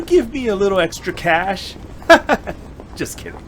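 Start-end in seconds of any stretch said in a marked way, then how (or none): 1.8-2.9 cheeky laugh. Acted. Joking about extra cash. 3.0-3.4 soft aside. Acted. After the joke.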